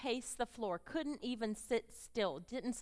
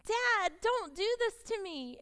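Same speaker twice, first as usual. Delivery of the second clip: high, pleading cry to dad; son's plea; 10 semitones higher, 5 dB louder than usual. Retold or imitated. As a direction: beg your father to stop. Son begs father at rehab.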